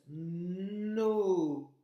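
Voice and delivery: "No" avec un long n puis un o tenu, de plus en plus fort; décomposer "No" en tenant chaque son; deux sons étirés. Shown as a demonstration. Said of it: "Donc, deux sons". (« No » très prolongé, nnn puis ooo)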